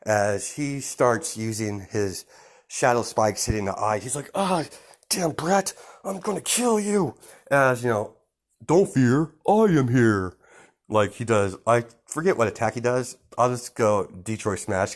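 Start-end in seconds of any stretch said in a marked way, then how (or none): 4.3-7.2 high-pitched mock rage; pained villain fury. Sludge villain threatening the boy who hurt his eye, high and furious. 8.7-10.3 loud, raised hero voice; heroic announcement. Acted. All Might arriving to save the boy, loud heroic proclamation.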